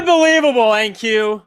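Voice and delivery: loud, high cry of disbelief; exasperated outburst. Real, not acted. Trader stopped out by NQ, exasperated shout.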